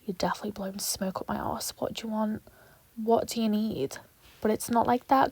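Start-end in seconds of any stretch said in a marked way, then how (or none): 0.1-4.0 hushed, wary muttering; softer, doubting whisper at flattery; suspicious whisper. Retold or imitated. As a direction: whisper it like you smell a trick. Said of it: "you're like".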